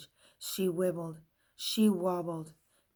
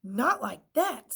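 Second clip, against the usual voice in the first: groaned; 4 semitones higher, 6 dB louder than usual. A narrator's reading. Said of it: "Stapler groaned".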